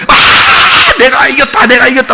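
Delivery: put-on high, shouted boast of a little boy; son's victory shout. 아빠를 이긴 아들이 신나게 외치는 승리의 고함. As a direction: crow it out like a child who just won. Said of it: "막 이런다고".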